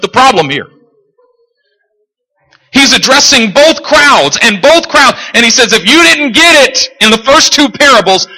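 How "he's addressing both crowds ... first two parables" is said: raised, high-pitched preacher delivery; emphatic preaching. Real, not acted. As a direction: preach it to both crowds. Preaches at full force.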